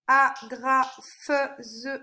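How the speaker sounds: "Agrafeuse" very slowly as a-gra-feu-se; teacher's drawn-out syllable split of "Agrafeuse". Shown as a demonstration. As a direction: sound out "Agrafeuse" in four separate syllables. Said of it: "feu sur la troisième syllabe".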